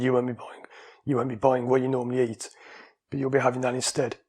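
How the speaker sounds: ordinary talk, nothing marked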